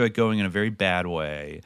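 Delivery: ordinary delivery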